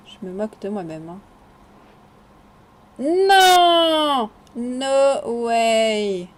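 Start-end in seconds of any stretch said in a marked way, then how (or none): 3.0-6.3 loud high shout of disbelief; real amazed outburst. Real, not acted. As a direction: cry out in happy shock. Delighted at enchant result.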